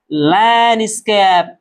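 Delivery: लैंडस्केप slow, as lan-scape without D; silent D. Shown as a demonstration. Tutor gives pupils the correct reading, D dropped, slowly. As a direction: draw out लैंडस्केप, leaving out the D. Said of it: "इसे नहीं पढ़ेंगे".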